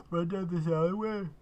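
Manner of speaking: talking through a yawn. Real, not acted. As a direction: yawn mid-sentence. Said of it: "Oh, sorry for yawning".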